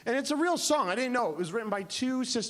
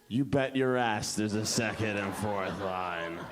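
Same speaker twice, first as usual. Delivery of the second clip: much lower, slow cocky boast; cocky Mildred; 11 semitones lower, slower than usual. Retold or imitated. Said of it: "she goes".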